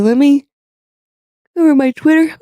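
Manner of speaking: talking through a yawn. Real, not acted. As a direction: stretch the words out mid-yawn. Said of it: "sorry, I'm yawning".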